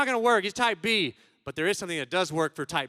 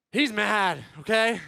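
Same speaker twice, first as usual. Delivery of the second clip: normal talking voice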